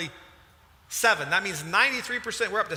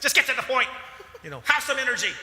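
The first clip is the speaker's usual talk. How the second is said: higher and louder, impatient mock plea; exasperated outburst; 8 semitones higher, 4 dB louder, faster than usual. Husband voicing his urge to push the counselor, louder and impatient. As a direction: blurt it out like you've lost patience. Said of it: "that's what I wanna say".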